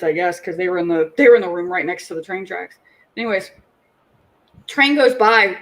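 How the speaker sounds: usual speaking voice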